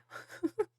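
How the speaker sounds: a sudden high giggle; high burst of laughter. Real, not acted. Streamer caught off guard by the alert, high giggle.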